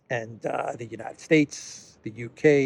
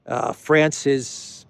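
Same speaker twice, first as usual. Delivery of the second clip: ordinary talk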